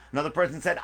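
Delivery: ordinary delivery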